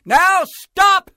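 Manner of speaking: very high, loud halt order; commanding shout. A narrator's reading. Max ending the rumpus, high commanding shout. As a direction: shout it high over a noisy crowd. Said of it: "Max said".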